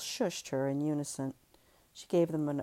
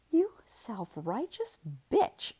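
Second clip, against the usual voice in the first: slow, scornful insult; slow scornful sneer; contempt; 6 semitones higher, slower than usual. Acted. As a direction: spit it out with contempt. Ellie's angry insult.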